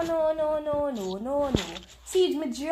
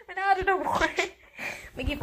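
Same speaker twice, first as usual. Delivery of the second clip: giving up through laughter; laughing surrender; 8 semitones higher, faster than usual. Real, not acted. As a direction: laugh the words out.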